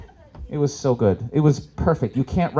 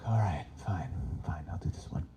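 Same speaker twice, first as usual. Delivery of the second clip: soft, unvoiced, lower whisper; whispered resignation; 8 semitones lower, 14 dB quieter than usual. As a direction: give in under your breath. Resigned aside to himself.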